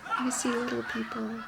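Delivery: put-on lightly spook it like the boy in the film; Haley Joel Osment whisper. Mother joking about her kids, hint of a spooky boy. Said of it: "that Haley Joel Osment character".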